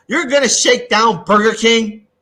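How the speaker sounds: loud, slow disbelief; incredulous. Real, not acted. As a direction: make it sound absurd. Incredulous at extortion.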